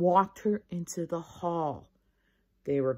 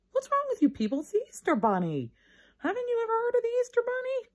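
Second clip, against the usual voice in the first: much higher, scornful disbelief; a bossy girl huffing at her classmates; exasperated; 5 dB louder than usual. Acted. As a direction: scold the class for not knowing.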